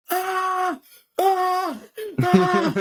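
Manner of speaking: high, loud, strangled panicky yelps; imitating a comedian melting down on stage; mock anxious wail. Retelling the comedian's stage panic as mock anxious yelps. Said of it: "I can either go on stage and go".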